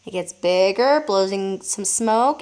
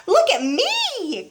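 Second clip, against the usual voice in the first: put-on an old woman calling from the roof; grandma shouting; 11 semitones higher, much slower than usual. A narrator's reading. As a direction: stretch it out for attention. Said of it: "Grandma shouted from the rooftop".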